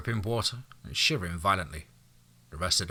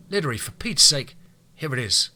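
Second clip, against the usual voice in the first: louder, fed-up emphasis; exasperated; 6 semitones higher, 6 dB louder than usual. Acted. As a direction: throw up your hands, fed up. Exasperated lecture.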